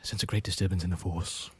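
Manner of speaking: low, hushed, solemn master; grave impression, pitched well down; Obi-Wan. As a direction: intone it softly and gravely. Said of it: "cuts to Obi-Wan going".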